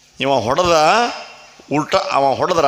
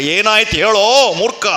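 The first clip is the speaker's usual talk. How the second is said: louder, higher impatient order; angry demand; 5 semitones higher, 5 dB louder than usual. Acted. King angrily ordering the stammering boys to speak. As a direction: bark at the fool to speak.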